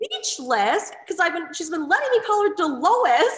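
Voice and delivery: high, laughing through the words; can barely get it out for laughing. Real, not acted.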